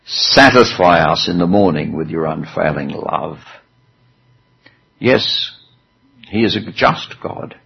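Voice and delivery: ordinary delivery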